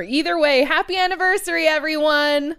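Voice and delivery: high cheery announcer call; bright host greeting. Acted.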